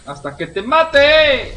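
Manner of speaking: high, drawn-out combat yell; battle cry. Real, not acted. Gamer firing until the enemy dies, battle cry. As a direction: stretch it out in a rising yell. Taunting enemies while firing.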